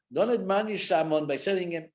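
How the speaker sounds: normal talking voice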